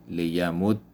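لیمد said "liyamuddi", doubled d then i; لیمد with final kasra, "li-ya-mud-di"; Arabic ending with kasra. Shown as a demonstration. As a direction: end لیمد on a short i. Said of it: "ادغام با حرکت کسره".

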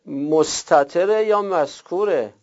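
ordinary talk

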